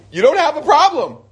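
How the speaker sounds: high-pitched, emphatic imitation; emphatic interjection. Woman on the phone reassuring the caller, emphatic. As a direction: cut in with eager insistence.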